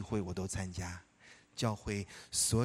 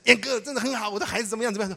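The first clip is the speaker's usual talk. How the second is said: eager greeting; 11 semitones higher, 7 dB louder, much faster than usual. Retold or imitated. Friend eagerly asks about children.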